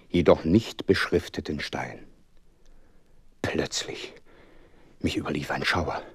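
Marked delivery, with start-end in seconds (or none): 3.4-6.0 hushed narration. A narrator's reading. Narrator's eerie moment.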